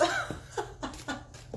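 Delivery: high breathy giggle at own stumble; real giggle. Real, not acted. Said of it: "I keep stuttering".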